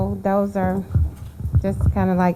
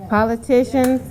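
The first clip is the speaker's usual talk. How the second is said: normal talking voice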